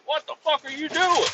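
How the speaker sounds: high, sharp mock-angry yell; high-pitched angry demand; angry outburst. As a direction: imitate the guy snapping at you. Angry coworker confronting him.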